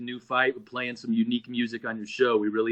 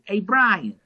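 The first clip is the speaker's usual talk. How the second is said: high-pitched impression of a scatterbrained bandmate; Johnny; 8 semitones higher than usual. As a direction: imitate the bandmate calling out high with news. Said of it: "Johnny goes".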